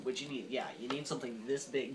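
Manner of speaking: ordinary talk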